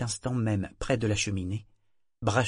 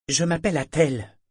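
ton revêche; 7 semitones higher, 4 dB louder than usual. A narrator's reading. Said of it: "d'un ton revêche".

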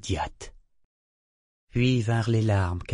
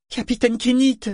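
cri de garçon très aigu et fort; appel désespéré; 6 dB louder than usual. A narrator's reading. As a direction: crier le nom du capitaine, au comble de la détresse. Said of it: "répéta Yémin sur un ton désespéré". (le mousse répète son appel au capitaine, criant, désespéré)

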